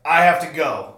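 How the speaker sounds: loud and abrupt, no explaining; urgent loud exit. Retold or imitated. Telling coworkers he must leave for his dog, urgent loud call. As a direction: call it over your shoulder on the way out.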